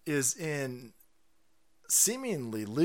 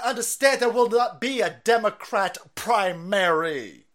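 mock threat; 6 semitones higher, 7 dB louder than usual. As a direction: lay down the law, hammed up. Parodying the pundit's warning.

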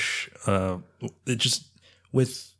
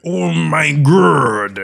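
very slow, much higher and louder hammed-up dub cry; shitty Joseph Joestar dub; 8 semitones higher, 14 dB louder, much slower than usual. Mocking a bad dub actor, slow loud hammed-up cry. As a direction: drag it out like a bad English dub. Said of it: "that was a really shitty Joseph Joestar".

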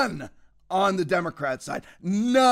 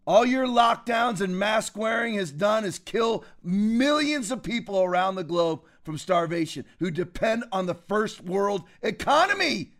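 ordinary talk, nothing marked

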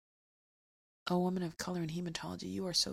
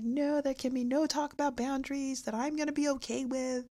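higher, a bit louder, indignant refusal; mock objecting listener; 8 semitones higher, 4 dB louder than usual. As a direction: play the stubborn listener who won't hear it. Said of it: "if you're like".

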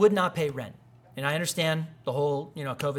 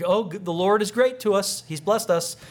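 louder, higher, put-on religious gush; mock-pious contractor; 5 semitones higher, 5 dB louder than usual. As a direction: lay on the fake piety. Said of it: "Gotta throw a couple of religious things at 'em".

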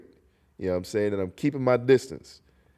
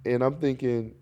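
usual speaking voice

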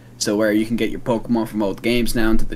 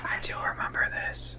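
hushed playful whisper; 13 dB quieter than usual. Real, not acted. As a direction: whisper it like a secret. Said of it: "Why are we whispering?".

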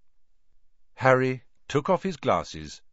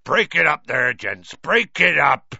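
louder, big burly voice; booming over all; 7 semitones higher, 9 dB louder than usual. Acted. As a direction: bellow over the crowd. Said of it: "louder than all".

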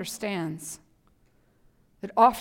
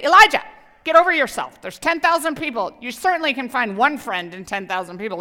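mock scolding; 3 semitones higher, 8 dB louder than usual. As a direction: snap at him, fed up.

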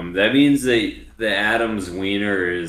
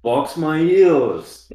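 high, very slow British mimicry; a hammed-up English gent; mock British voice; 7 semitones higher, 4 dB louder, much slower than usual. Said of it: "English person with a speech impediment".

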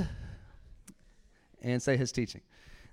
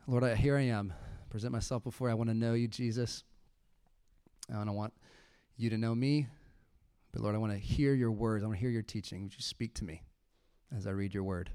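soft, lower, intimate prayer, softer; hushed prayer; 4 semitones lower, 6 dB quieter than usual. Shown as a demonstration. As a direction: pray quietly, alone on the sofa. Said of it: "let me show you one other thing".

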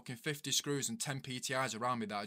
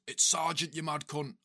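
sharp, louder, higher barked correction; retold angry snap; 5 semitones higher, 6 dB louder than usual. Sergeant snapping at a lad who used his name. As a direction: bark it like an offended new sergeant.

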